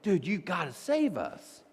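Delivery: drawn-out mock-desperate plea; urgent pleading. Disciples shaking Jesus awake, mildly acted urgent plea. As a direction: plead slowly, stretching the words. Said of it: "it probably wasn't gentle".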